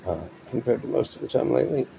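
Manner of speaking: ordinary talk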